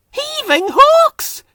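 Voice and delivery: high, slow startled cry. A narrator's reading. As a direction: cry out in alarm. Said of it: "Kevin cried".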